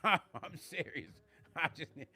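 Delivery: slow, broken up by a laugh; laughing protest. Real, not acted. Streamer insisting to viewers he's serious, laughing. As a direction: chuckle through the insistence.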